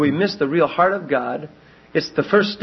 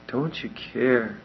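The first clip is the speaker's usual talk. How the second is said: softer, slow; quiet indictment; 5 semitones lower, 7 dB quieter than usual. Acted. Repeating Martha's words to congregation, soft slow accusation. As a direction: say it low and slow. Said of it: "That's a horrible indictment".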